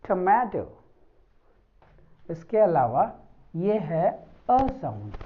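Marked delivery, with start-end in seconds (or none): 0.0-0.6 tomato in an American accent; American tomato with an aspirated t. Shown as a demonstration. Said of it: "just like American".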